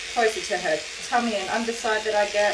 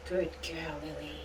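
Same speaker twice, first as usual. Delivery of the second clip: soft murmured praise; 4 semitones lower, 12 dB quieter than usual. Real, not acted. Murmuring praise to calm dog.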